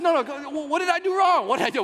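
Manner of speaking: high-pitched mock pleading; begging. Husband asking his wife what went wrong, high begging. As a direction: beg like a husband in trouble. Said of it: "We start going to the begging mode".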